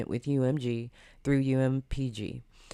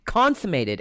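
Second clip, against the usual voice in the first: mocking; 5 semitones higher, 6 dB louder than usual. Real, not acted. Repeats word louder, mocking.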